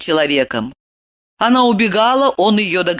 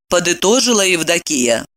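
ordinary delivery

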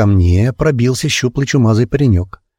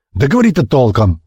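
raised, impatient demand; mild bark; 4 semitones higher than usual. A narrator's reading. Uncle losing patience with the boy, mildly barking. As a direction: cut in with restrained impatience. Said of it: "рявкнул Остах".

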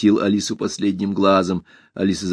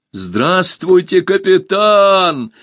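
high, loud, very drawn-out robot greeting; ringing, slow robot salute; saluting robot; 9 semitones higher, 4 dB louder, much slower than usual. A narrator's reading. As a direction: hail the captain like a proud machine.

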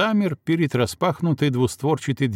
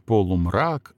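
plain reading voice

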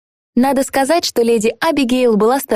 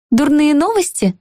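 put-on higher, curious young woman; young lady voice; 7 semitones higher than usual. A narrator's reading. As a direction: ask it lightly, a touch higher. Evani asks, voiced high.

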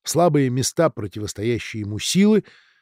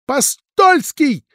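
much higher, loud, drawn-out cry; sharp cry; 14 semitones higher, 7 dB louder, much slower than usual. A narrator's reading. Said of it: "Резкий женский вскрик".

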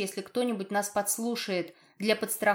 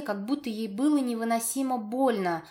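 ordinary delivery

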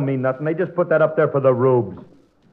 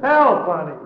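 calling for help; 7 semitones higher than usual. Acted. Stuck man calls for help.

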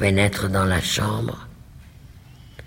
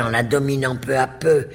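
ordinary delivery

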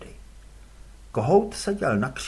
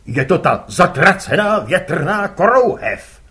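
louder gruff curt reply; gruff father; 3 semitones higher, 10 dB louder than usual. Acted. Father answers his son curtly, gruff.

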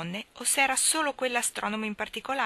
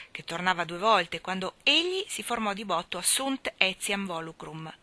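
ordinary delivery

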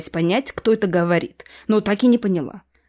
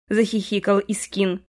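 usual speaking voice

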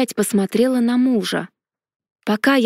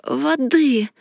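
hoarse croak; 3 semitones higher than usual. A narrator's reading. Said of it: "прохрипела я".

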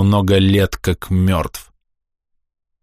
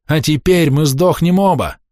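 mental shout; 8 semitones higher than usual. A narrator's reading. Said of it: "мысленно закричал Павел".

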